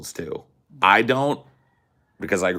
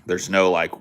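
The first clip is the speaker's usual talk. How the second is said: ordinary talk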